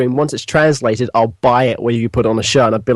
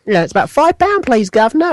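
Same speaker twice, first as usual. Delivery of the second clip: much higher, chirpy mock cockney attendant; cheeky attendant; 9 semitones higher than usual. Fake attendant charging a driver, chirpy and high. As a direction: sell the fake fee with a cheery lilt.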